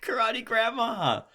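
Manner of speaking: high, slow savouring of Karate Grandma; delighted echo. Acted. Co-host loves the Karate Grandma premise, high slow delight. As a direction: relish the phrase Karate Grandma.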